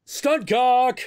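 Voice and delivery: high, loud interjected yell; hammed-up high shout; mock shouted call. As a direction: yell it like a film crew calling out. Imitating the movie's repeated call.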